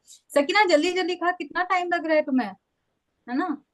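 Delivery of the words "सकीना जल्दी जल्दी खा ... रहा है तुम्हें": higher, hurried mock scolding of herself; nagging inner voice. Inner critic rushing Sakina through her meal, higher nagging tone. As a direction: replay the pushy tape in your head, pitched up. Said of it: "मेरा दिमाग यहाँ से वही वो tape चलाएगा".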